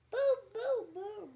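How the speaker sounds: high, loud thunder booms; booming thunder. Acted. Telling a child how thunder sounds, loud booms. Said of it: "Mister Brown makes thunder".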